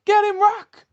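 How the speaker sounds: put-on high, excited child's cheer; kids cheering. Rocky's kids at home, a high excited cheer. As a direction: cheer on your dad from the sofa. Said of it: "Rocky's kids are home like".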